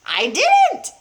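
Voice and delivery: high, loud cackle; a sly old crone cackling; cackling old woman. A narrator's reading. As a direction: cackle it with glee. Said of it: "cackled the old woman".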